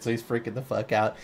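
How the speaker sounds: ordinary delivery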